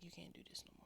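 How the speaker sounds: hushed whisper of a strict dad; whispered quote. Retold or imitated. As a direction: breathe it out in a whisper.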